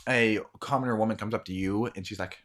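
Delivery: normal talking voice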